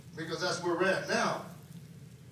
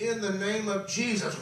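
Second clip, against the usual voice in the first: firmer and brighter, a step up in force; bold declaration; 3 semitones higher than usual. Retold or imitated. Preacher declaring the name of Jesus in faith, firm and rising. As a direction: say it like you finally believe it.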